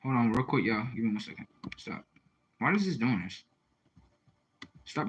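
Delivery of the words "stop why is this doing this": usual speaking voice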